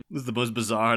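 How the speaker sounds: ordinary delivery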